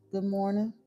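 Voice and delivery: ordinary delivery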